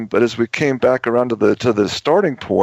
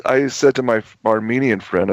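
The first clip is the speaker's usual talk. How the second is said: normal talking voice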